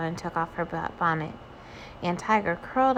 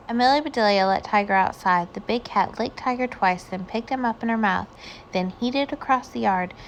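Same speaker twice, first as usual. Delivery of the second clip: plain reading voice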